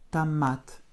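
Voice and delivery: "Томат" as ta-MAT, accent on the final syllable; O as light A. Shown as a demonstration. Teacher repeating the word for pupil, "Томат" with O as light A. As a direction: turn the о of "Томат" into a short light A. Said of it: "presque prononcé comme un, un léger A".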